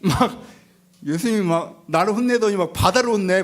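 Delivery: ordinary talk, nothing marked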